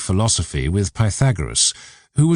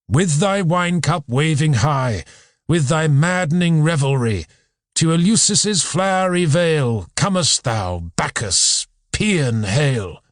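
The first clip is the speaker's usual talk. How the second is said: higher, measured declamation of verse; lofty spoken hymn, pitched up; hymn recitation; 6 semitones higher than usual. Acted. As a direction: recite it as a solemn invocation, line by line.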